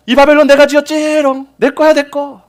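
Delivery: high, loud taunting brag; boastful gloat. Acted. Nebuchadnezzar boasting from the palace roof, high and gloating. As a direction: crow over it like a smug child. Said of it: "이렇게 하죠".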